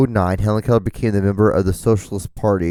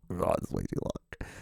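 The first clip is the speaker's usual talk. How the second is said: a whispered, much softer repeat; 5 semitones lower, 16 dB quieter than usual. Retold or imitated. Podcaster repeating himself to listeners in a whisper. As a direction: whisper it to yourself, half laughing. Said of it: "'cause I'm like".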